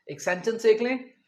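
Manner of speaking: ordinary delivery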